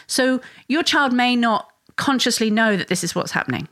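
normal talking voice

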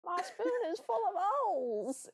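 very high squeaky quoting; high whiny voice. Retold or imitated. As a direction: squeal it in a mock whine. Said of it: "It's the".

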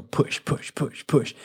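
soft breathy urging of push; pressing whisper. Retold or imitated. Soldiers advancing on you, whispered pushing. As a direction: whisper the pushes like a slow advance. Demonstrating an enemy pressing forward.

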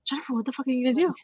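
fast, blunt disbelief, imitating best friend; incredulous friend. Best friend on the phone, stunned at her plan: fast incredulous mock. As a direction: rattle it off like a stunned friend calling you out. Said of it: "she looks at me and she goes".

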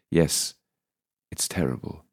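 a touch of grief held back; quiet, sorrowful widow's answer; mild sadness. A narrator's reading. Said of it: "She sounds sad".

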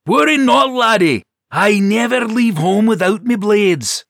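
a cheery Scots warrior boasting; Scottish dwarf. A narrator's reading. Dirk reassuring the knight, loud Scottish brogue. Dirk's Scottish-ish reply.

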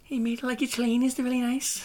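high-pitched put-on Scouse accent. Co-host joining the Scouser joke, mock Scouse accent. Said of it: "as a Scouser".

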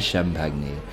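"champagne" said as sham-PAG-nay, in a low voice; mispronounced champagne. Acted. Waiter mangling the French word, "champagne" mispronounced as sham-pag-nay. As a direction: sound out the g and end "champagne" on nay. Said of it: "It's just you mispronouncing it".

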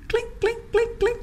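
very high, soft little clinks; tiny, light clinking sound for each step; imitated footsteps. Host mimics her mentor's approaching feet, high soft clinking. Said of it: "then I hear her feet".

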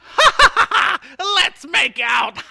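high shrieking laugh into a loud come-on; cackle, then shout the line; maniacal laugh. Retold or imitated. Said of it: "And I'm just like".

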